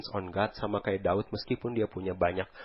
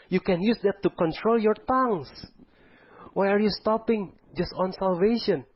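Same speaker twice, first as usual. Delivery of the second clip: rising, ever higher preaching push, slowing at the end; preaching at full force; 8 semitones higher than usual. Acted. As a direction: drive each line harder like a pulpit climax. Preacher building to climax.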